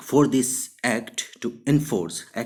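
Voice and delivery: very slow, clear reading; teacher dictating example; slow dictation. Shown as a demonstration. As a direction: read it word by word. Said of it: "example sentence".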